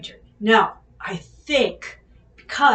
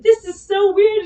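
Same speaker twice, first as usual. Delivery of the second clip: giggly disbelief; 9 semitones higher, 8 dB louder than usual. Retold or imitated. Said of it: "I said".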